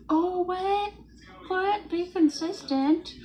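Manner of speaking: high, loud put-on gasp of disbelief; mock shock. To her viewers, mocking the word consistent with high surprise. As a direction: feign outrage at the idea.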